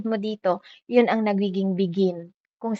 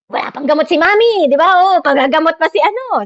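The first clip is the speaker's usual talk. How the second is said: high, louder anxious voice; worried complaint; 7 semitones higher, 6 dB louder than usual. Retold or imitated. A worried relative complaining about medicine, high anxious voice. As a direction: fret about mother's medicine.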